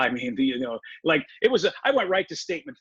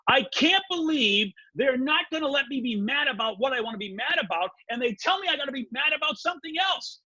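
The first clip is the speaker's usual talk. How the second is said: angry rant; 8 semitones higher than usual. Retold or imitated. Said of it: "in the midst of screaming and yelling".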